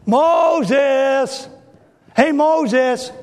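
loud, high call across a distance. Acted. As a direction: shout Moses' name to fetch him. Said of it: "God comes by and he goes".

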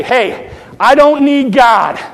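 loud, high boastful macho voice; Mister Macho. Retold or imitated. Pastor mocking a self-reliant man, loud macho boast. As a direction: swagger it out, shouting he needs no one. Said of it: "if I am Mister Macho".

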